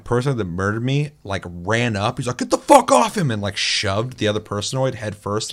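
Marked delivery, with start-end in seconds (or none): 2.4-3.3 loud, high-pitched mock yell; retold angry shout. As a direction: shout it like rushing into a fight. Retold player rushing to attack.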